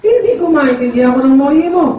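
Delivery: mock confused father. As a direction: question it, bewildered.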